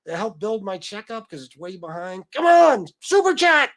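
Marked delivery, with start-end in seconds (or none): 2.3-3.7 hyped plea. Real, not acted. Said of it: "begging for super chats".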